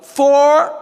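loud, high golf-course shout; drawn-out, high warning yell. Acted. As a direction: yell a long warning across the fairway. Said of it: "you hear somebody yell".

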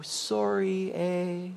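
mild Canadian sorry eh. Retold or imitated. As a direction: mumble the apology under your breath. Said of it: "forgets to open the door".

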